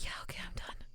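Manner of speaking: soft whisper, a little conspiratorial; secretive whisper. Retold or imitated. Streamer retelling how ASMR began, hushed secretive whisper. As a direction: whisper it like a secret you're giving up. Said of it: "I decided that I needed to whisper".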